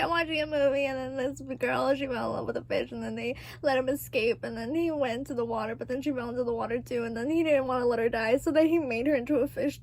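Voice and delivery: soft, running-on mock-tearful account; small retold explanation. Kid explaining the movie to mom, small and soft. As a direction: reel it off quietly, clause after clause.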